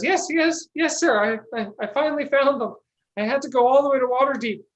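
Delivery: high, fast, flustered stammer; a jittery helper rushing to please the mayor; nervous Jerry. Acted. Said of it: "And Jerry goes".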